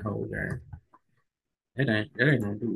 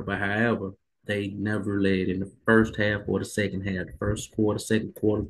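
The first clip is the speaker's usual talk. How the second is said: ordinary delivery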